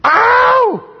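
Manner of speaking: high, loud hammed-up yelp; mock pained yelp. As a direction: yelp out like he's being tortured. Cry of torment.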